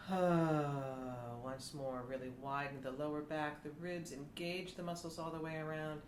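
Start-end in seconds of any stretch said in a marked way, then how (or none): 0.1-1.3 sighed ah. Shown as a demonstration. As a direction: open sigh on ah. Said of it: "Sigh it out".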